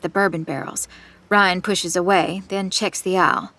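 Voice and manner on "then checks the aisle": plain reading voice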